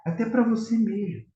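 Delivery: ordinary talk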